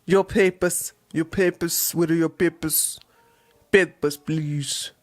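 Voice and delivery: put-on low, soft second try at a German accent, slowing at the end; retry German accent. Vlogger retries his German accent for the airline guard. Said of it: "I need to improve my German accent".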